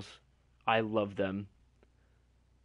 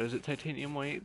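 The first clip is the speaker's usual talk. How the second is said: ordinary talk, nothing marked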